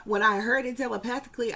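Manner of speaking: normal talking voice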